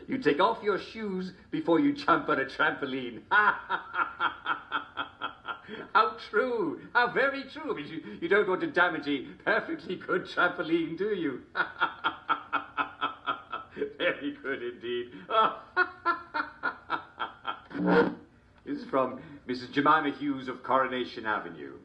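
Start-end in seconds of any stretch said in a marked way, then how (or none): 3.2-5.9 high rising cackle. Real, not acted. Host laughs at his joke. 5.9-7.7 much higher, gushing delight; overjoyed gush, pitched way up. Real, not acted. Host delights in the joke. 15.1-18.2 hearty laugh. Real, not acted. Host laughs after joke.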